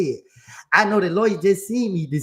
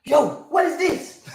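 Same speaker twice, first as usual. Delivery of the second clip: high, drawn-out indignant demand; acted-out indignation, high and slow; mad lawyer; 8 semitones higher, much slower than usual. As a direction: play the lawyer confronting a client, pitched up. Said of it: "you mad, ain't it?".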